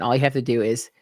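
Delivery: ordinary delivery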